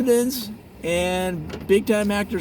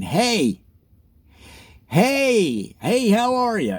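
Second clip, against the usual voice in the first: cheery hey greeting; 5 semitones higher, 3 dB louder, slower than usual. Retold or imitated. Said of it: "They just started saying".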